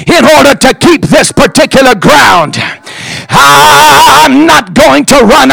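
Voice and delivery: shouted pulpit speech, building from measured phrases to high full force; defiant Shammah. Acted. Preacher voices Shammah refusing to back down, defiant building shout. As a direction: punch out each defiant phrase, climbing into a sustained preacher's shout. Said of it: "And Shammah says it like this".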